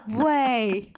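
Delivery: loud, high no way with a laugh; can't believe the two lines; stunned disbelief. Retold or imitated. Reading the test result, loud and laughing. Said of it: "I'm like".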